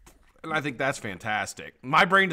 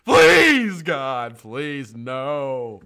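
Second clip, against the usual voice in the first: high, loud hammed-up pleading; mock begging; 5 semitones higher, 10 dB louder, much slower than usual. Laid-off worker begging the boss, hammed-up pleading. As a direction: beg for your job like it's a farce. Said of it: "Go out and be like".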